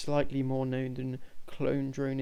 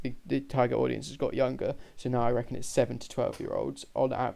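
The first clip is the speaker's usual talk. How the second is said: ordinary talk, nothing marked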